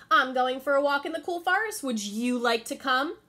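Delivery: butter her up like a schemer; sly Anansi. Acted. Anansi sweet-talking Little Bush Deer, sly and loud.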